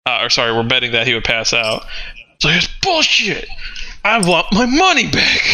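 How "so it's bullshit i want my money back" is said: higher, aggrieved complaint; angry bettors. Acted. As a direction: demand the money back, indignant.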